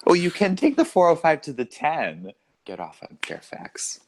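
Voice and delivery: California accent, starting high, dropping off; put-on California accent. Shown as a demonstration. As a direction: give freeway directions in Californian. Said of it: "more of a California way of talking".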